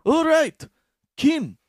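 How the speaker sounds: high loud hammed-up host voice; mock host calling a name; game show host. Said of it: "it's like".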